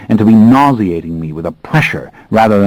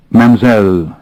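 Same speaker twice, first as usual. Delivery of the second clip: Mademoiselle with a put-on thick French accent; deliberately heavy French accent; 3 semitones higher, 4 dB louder than usual. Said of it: "deliberately thickening my French accent".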